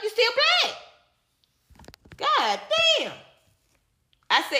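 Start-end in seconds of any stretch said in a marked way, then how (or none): 0.0-3.1 exasperated. Real, not acted. Exasperated rant.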